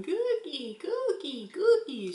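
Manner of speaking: high, whiny begging for a cookie; cranky toddler whine. Retold or imitated. Mom imitating her cranky daughter begging for cookies. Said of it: "Mackenzie starts going".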